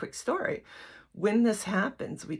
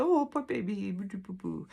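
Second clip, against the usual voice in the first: mock sympathy; 3 semitones higher than usual. Mocking the sympathy she wanted, cooing baby talk. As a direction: coo at a sulking child, mocking. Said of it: "You just want the sympathy".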